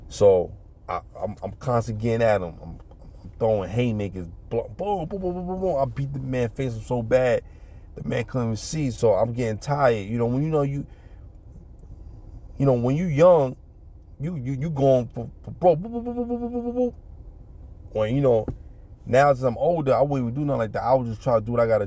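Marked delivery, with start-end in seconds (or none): 4.5-5.8 quick soft mock boom noises; light boom sound effects; punch sounds. Imitating punches. 15.8-16.9 high rapid boop noises; playful high boop sound effects; punch sounds. Retold or imitated. Imitating punches.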